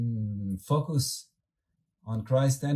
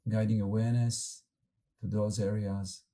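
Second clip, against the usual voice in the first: calm, unhurried meditation guide with long pauses; guided meditation. Acted. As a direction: gently lead the group inward, letting words settle.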